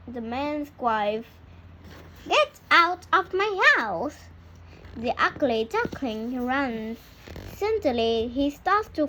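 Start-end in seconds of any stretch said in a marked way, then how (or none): 2.3-4.2 higher, sharper shout of a housewife; angry wife's shout. A narrator's reading. The man's wife drives the duckling out, angry. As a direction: chase him out in anger. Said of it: "cries the man's wife".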